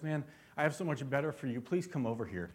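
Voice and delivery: soft, gentle fatherly invite; tender father. Pretend. Pastor voicing God as a father speaking to his child, softly tender. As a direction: coax them close like a loving dad.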